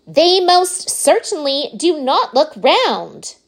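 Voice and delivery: high, slow, emphatic protest; indignant cry. A narrator's reading. Veruca contradicts Wonka about the candies, indignant high cry. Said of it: "cried Veruca Salt".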